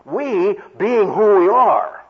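"we" stressed high and slow, with real weight; "we being who we are" with heavy, slowed emphasis on "we"; emphatic we. Shown as a demonstration. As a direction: lean hard on each "we", drawing it out. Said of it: "the proper way of understanding that in English by emphasis".

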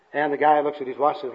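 usual speaking voice

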